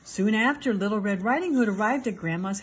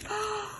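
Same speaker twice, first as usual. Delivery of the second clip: shocked gasp; 12 semitones higher, 8 dB quieter than usual. Acted. Wolf eats the girl.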